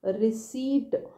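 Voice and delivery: रिसीट said slowly with no P sound; silent p. Shown as a demonstration. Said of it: "पी का sound नहीं है इसमें". (रिसीट को धीरे बोलें, पी साइलेंट रखें)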